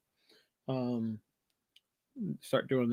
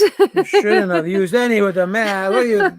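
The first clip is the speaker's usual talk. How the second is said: loud, high, slow mock scolding; mock outrage; 6 semitones higher, 11 dB louder than usual. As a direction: scold them in playful disbelief. Mock outrage about mask photos.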